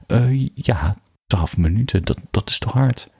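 falter, then lift into an uncertain question; unsure. A narrator's reading. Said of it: "vroeg ze onzeker".

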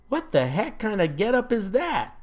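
incredulous, pitch jumping up; incredulous challenge. A narrator's reading. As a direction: demand an explanation, taken aback. Grizzled homeowner greets costumed salesman.

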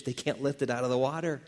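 normal talking voice

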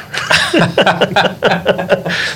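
high embarrassed giggle; a sheepish burst of laughter; laughing. Real, not acted. Laughs at own burp.

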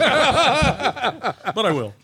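high, slow, laughing mock repeat; laughing echo. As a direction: drag it out through laughter.

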